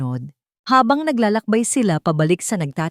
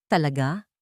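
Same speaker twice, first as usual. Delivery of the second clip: bitter scoff; 3 semitones lower than usual. A narrator's reading. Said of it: "Isang mapait na tawa ang kumawala".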